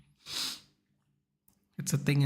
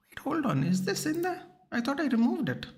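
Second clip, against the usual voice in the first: much higher, sudden disbelief; startled surprise; 12 semitones higher than usual. Real, not acted. Noticing a clip he thought was removed, surprised and higher. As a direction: caught off guard by his own mistake.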